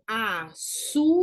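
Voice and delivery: "Açúcar" stretched slowly, stress on "çú"; syllable by syllable. Shown as a demonstration. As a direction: break "Açúcar" into slow syllables, A-ÇÚ-CAR. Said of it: "na segunda sílaba". ("Açúcar" separado em sílabas, devagar)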